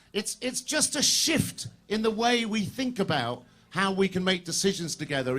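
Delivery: ordinary delivery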